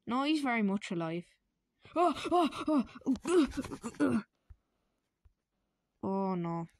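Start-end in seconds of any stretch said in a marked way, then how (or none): 1.9-4.2 high, loud gasps for air; gasping. Acted. Luigi gasping for breath as he collapses. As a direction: choke out each oh.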